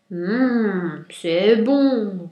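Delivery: loud, drawn-out roar of relish; big loud lion savouring a mouthful. A narrator's reading. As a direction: roar it out with greedy pleasure. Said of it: "rugit le lion".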